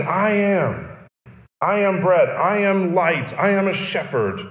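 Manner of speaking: preacher voicing Jesus's I am sayings; proclaiming. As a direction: declare each "I am" like a claim. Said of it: "And he says".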